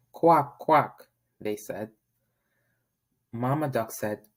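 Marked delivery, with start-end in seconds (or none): 0.1-1.1 high, loud little quacks; ducklings quacking. Acted. Ducklings answering Mama Duck, loud quacks.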